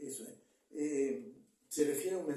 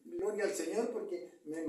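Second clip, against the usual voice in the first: ordinary talk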